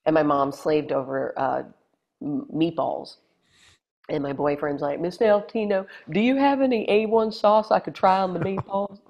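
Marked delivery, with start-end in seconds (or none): 5.0-9.0 put-on higher, clueless boyfriend voice; a touch of a goofy boyfriend; naive boyfriend. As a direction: ask innocently, oblivious.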